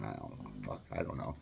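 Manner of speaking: puzzled mutter while staring at the acid; muttered to himself. Retold or imitated. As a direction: mumble it to yourself, baffled.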